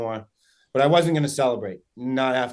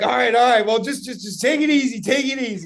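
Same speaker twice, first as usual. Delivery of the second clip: high, stammering retold plea; urgent repeats that settle toward the end; flustered calming down; 9 semitones higher, 6 dB louder than usual. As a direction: rein in excited friends, voice raised. Retold plea to excited friends.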